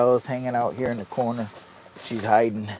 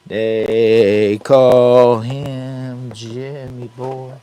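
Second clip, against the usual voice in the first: slow, louder, higher showman call; drawn-out announcer intro; 4 semitones higher, 7 dB louder, much slower than usual. Pretend. Introducing himself to viewers as a slow hammed-up announcer. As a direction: stretch it out like a ringmaster. Self-intro catchphrase to camera.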